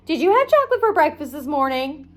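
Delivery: ordinary delivery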